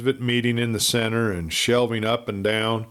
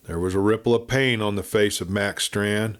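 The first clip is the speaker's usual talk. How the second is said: usual speaking voice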